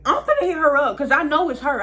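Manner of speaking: imitating sister itching for a confrontation; fired-up threat. Sister vowing to confront the girl.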